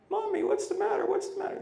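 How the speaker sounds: put-on high, soft little-child voice; small child. Toddler asking his weeping mother, high and soft. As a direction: ask like a worried toddler.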